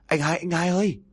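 high, frantic shout. A narrator's reading. Son yelling for his older brother, high and frantic. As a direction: yell for the brother upstairs. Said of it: "tiếng kêu lớn của cậu ba".

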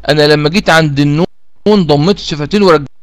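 normal talking voice

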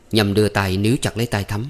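usual speaking voice

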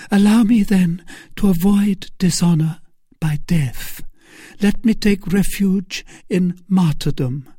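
high, pleading woman's voice; pleading Milady. A narrator's reading. Milady begging Felton to let her die, high and pleading.

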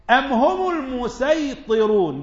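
high, loud, slow recited verse; Quran recitation. Acted. Teacher reciting a verse to students, forceful chant.

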